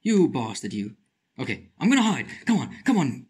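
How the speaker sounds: ordinary delivery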